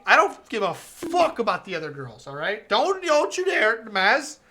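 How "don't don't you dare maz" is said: loud, high mock threat; playful threat. Real, not acted. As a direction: threaten him in mock fury.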